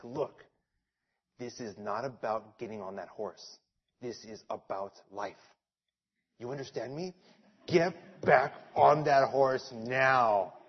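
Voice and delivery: low, soft, serious aunt voice; stern aunt. Retold or imitated. Aunt to crying nephew, low stern warning. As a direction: lay down the law quietly. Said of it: "She was like".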